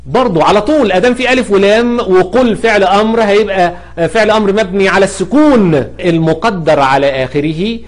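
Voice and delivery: normal talking voice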